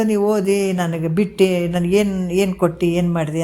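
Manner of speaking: ordinary delivery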